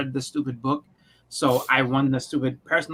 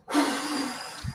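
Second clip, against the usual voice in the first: high unvoiced pained exhale; pained hiss; 10 semitones higher, 7 dB quieter than usual. Dad on hot pizza, pained hissing exhale. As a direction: suck air through the burn.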